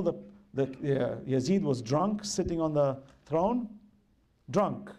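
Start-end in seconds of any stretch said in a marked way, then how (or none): none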